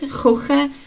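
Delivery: "kochen" with a light ch, just air coming out, no spit; gentle throaty ch in "kochen"; soft German ch. Shown as a demonstration. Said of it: "when we say it".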